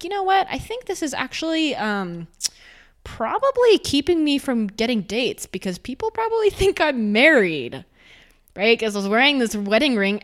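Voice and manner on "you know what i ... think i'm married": high, amused self-quote; playing her younger self realizing it; mock inner thought. To herself about the ring, a high amused musing. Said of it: "I was like".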